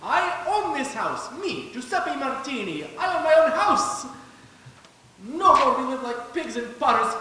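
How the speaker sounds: overjoyed new homeowner; proud exclamation. Acted. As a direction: boast with joy about owning a house. Immigrant proudly shows new house.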